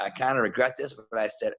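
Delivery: ordinary talk, nothing marked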